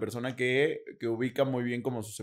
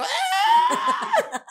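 mock pain scream; 7 dB louder than usual. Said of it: "me despertó uno".

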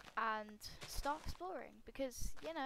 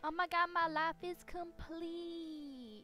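high, drawn-out ecstatic wail; hammed-up, overcome with joy; overjoyed squealing; 11 semitones higher, 5 dB louder, much slower than usual. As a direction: gush slowly, climbing into a squeal. Mock-ecstatic reaction to the biome.

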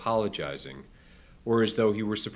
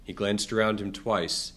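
usual speaking voice

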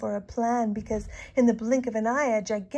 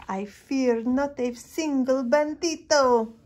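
brave declaration; 5 semitones higher, 7 dB louder, slower than usual. A narrator's reading. Hero summons courage.

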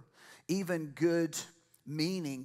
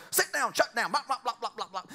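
high-pitched mock parental scolding; nagging parent; 6 semitones higher, faster than usual. Preacher mimicking parents for the congregation, nagging. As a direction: rattle off orders into blah-blah.

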